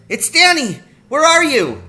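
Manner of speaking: high, loud urgent call; shouting. A narrator's reading. Boy calls to the voice he heard, shouting. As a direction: call out to locate someone. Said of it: "I shouted".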